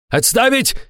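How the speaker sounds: high-pitched yelled order; yelling. A narrator's reading. Boss to his armed men, yelling. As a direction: call off the gunmen urgently. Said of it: "заорал тигрёнок".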